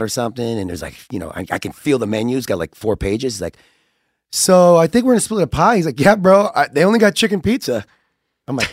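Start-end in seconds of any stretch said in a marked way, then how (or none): 4.3-7.8 dude voice. Retold or imitated. Voicing Riley.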